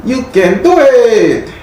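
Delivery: put-on very high, stretched-out Mexican-accented cheer; hammed-up Mexican voice, slow and soaring. Reader repeats Spirit's encouragement for a client in an exaggerated Mexican accent. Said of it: "That's my best Mexican voice".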